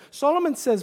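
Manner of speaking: ordinary talk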